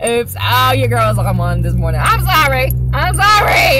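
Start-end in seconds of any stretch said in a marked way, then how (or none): 2.0-3.8 sing-song, loud and higher "I'm sorry"; loud mock apology. To the drive-through worker, a loud mock "I'm sorry". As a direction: belt out a hammed-up "I'm sorry".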